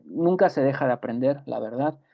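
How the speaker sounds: ordinary delivery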